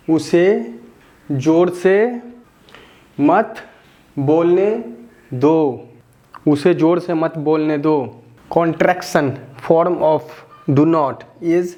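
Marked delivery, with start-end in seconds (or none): 0.1-5.9 teacher dictating each word separately. Shown as a demonstration. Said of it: "teacher builds the sentence word by word". 6.5-8.2 quick, the whole sentence in one breath; teacher recapping the full sentence briskly; run together. Shown as a demonstration. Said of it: "teacher says the whole sentence in one go".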